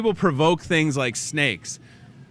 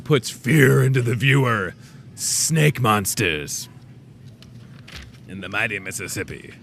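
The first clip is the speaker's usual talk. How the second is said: slow, ominous hype voice; scary show announcer; 4 semitones lower than usual. Pretend. Host jokingly scaring viewers, slow spooky announcer voice. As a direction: draw it out like a mock monster-show promo. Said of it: "let's scare people".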